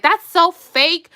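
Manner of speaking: drawn-out, loud, high mock sneer; mocking doubters. Vlogger mimicking people who doubt the show, mocking. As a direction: imitate sceptics, stretching it slowly. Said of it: "there'll be people that's like".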